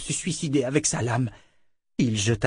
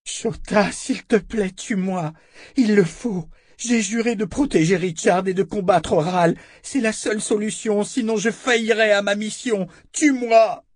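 broken-voiced plea; 11 semitones higher than usual. A narrator's reading. Callan begs Shota to kill her, broken-voiced and pleading. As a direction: implore desperately in a high, breaking voice. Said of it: "implora d'une voix brisée".

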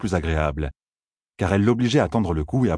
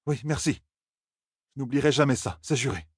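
soft, higher-pitched heartfelt thanks; grateful; 6 semitones higher, 3 dB quieter than usual. A narrator's reading. Grandson thanks Perrine for a fencing teacher, grateful. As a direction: vow it softly, still moved.

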